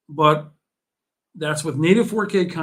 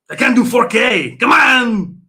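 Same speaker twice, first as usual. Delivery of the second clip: much higher, much louder yell of "They can't do four K"; real exasperated shout; 8 semitones higher, 13 dB louder than usual. Real, not acted. Ranting at chat about new consoles, real frustrated shout. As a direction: bellow it at the chat, fed up.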